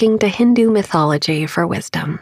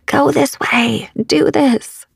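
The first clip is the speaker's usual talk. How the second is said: higher-pitched, put-on voice of a signpost; imagined sign's voice; 8 semitones higher, slower than usual. Neon arrow pointing out the path, in a high put-on voice. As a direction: lift the pitch as if voicing an arrow's directions.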